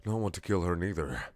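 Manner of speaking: ordinary delivery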